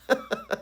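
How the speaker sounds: brief high-pitched laugh. Real, not acted. Reviewer laughing at the comic's smiling heroes, high laugh. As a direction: let out a short squeaky laugh.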